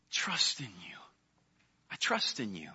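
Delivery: soft whisper of trust; hushed whispered devotion; whispered prayer. Acted. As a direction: whisper it close, like a secret to God. David's intimate psalm of trust.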